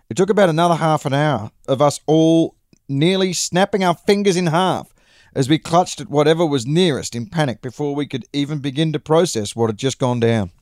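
ordinary delivery, nothing marked

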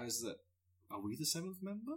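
quiet, wondering question; hushed. Pretend. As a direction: ask it under your breath. Quoting the bandmates, hushed.

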